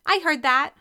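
high, loud retort; indignant retort. A narrator's reading. Sister overhears a muttered jab, retorting loudly.

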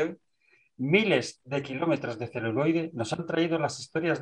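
measured, dramatic podcast opening; formal intro read. Acted. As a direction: read it like a cinema trailer intro.

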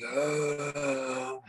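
long, drawn-out mock zombie moan; low, steady imitation zombie drone; zombie groan. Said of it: "it was a weird zombie thing".

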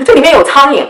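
retold complaint, pitched well up; high, pointed public complaint; deliberately loud complaint. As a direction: announce it for everyone to hear. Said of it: "我就故意说的很大声".

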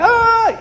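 sudden high yelp of a man falling; mock scream of a falling worker; falling man's cry. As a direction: yelp it out high like someone slipping off scaffolding. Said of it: "el hombre comienza a gritar".

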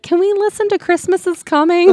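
put-on high childlike begging; pleading voice. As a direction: ask like an eager kid. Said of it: "I'm like".

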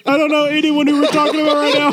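talking through laughter, high; laughing. Real, not acted. Laughing while speaking.

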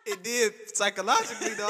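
high, loud laughing answer; laughing hard. Real, not acted. Man admitting an embarrassing experiment to cohost, laughing hard. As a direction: talk through helpless laughter. Laughing over whether it worked.

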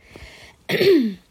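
loud throat clear for a frog in the throat. Real, not acted. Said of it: "Sorry, I got a frog in my throat".

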